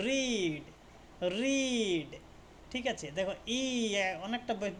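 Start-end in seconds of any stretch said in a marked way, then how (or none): 0.1-2.1 রিড stretched as "reeed"; long ee sound. Shown as a demonstration. Teacher showing long i for ea, রিড stretched. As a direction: draw out the ee in রিড. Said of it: "দীর্ঘ ইর মতো উচ্চারণ আসছে".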